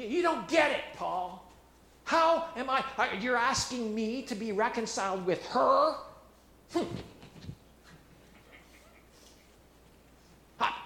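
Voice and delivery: rising to an indignant shout; indignant Syntyche. Acted. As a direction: Syntyche protests to Paul, shouting in outrage. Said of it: "Syntyche's sitting there".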